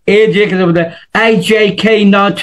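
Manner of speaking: usual speaking voice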